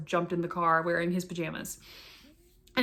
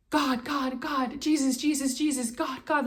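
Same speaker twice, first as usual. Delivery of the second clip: higher, pleading cry of God and Jesus; 6 semitones higher, slower than usual. Retold or imitated. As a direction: call out to God in shock and grief, higher. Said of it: "I was literally crying out".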